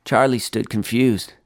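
usual speaking voice

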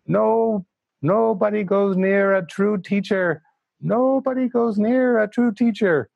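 put-on high, emphatic Indian English accent; Tara Singh's Indian accent. Tara Singh warning students, in an Indian English accent. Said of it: "Indian English accent".

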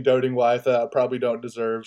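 ordinary talk

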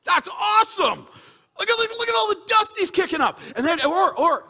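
thrilled preacher exclaiming; excited shout. Acted. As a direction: shout with wonder at the clouds.

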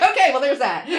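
real laughter through the words; mother giving up on the shower, laughing loudly. Real, not acted. Mom laughing at son's refusal.

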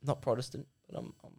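meek, very soft religious wimp; Rants. Shown as a demonstration. Host explains the rants character in his simpy, weak voice. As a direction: keep it feeble and hushed. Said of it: "just a bit simpy. Just a bit weak".

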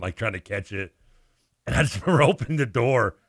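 ordinary delivery

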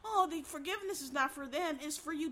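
mocking the people who tell her to forgive; condescending mockery. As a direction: parody a smug know-it-all. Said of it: "that condescending ass shit".

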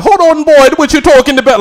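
mock angry Jamaican phone voice; imitating Jamaican switch. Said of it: "flip into a whole different language".

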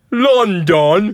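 "London" drawn out high and loud; mock posh "London". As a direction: say "London" high and rising, mocking. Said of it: "And they say".

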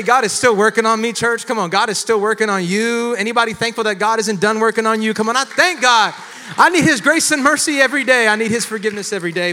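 high, loud, rousing preacher's cry; full-force preaching. Real, not acted. Preacher urging church to thank God, shouting exhortation.

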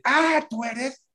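high-pitched gasp of realisation; startled recognition. Retold or imitated. Woman recognises the caller on the phone, startled.

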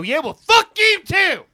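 furious shout. Acted. Woman yelling at the man who turned her down, furious. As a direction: scream it after him in outrage.

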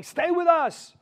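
high, loud, drawn-out urging; urgent plea. Acted. Disciples urging Jesus not to leave, loud urgent plea. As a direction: call out slowly, imploring him. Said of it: "But they urged him".

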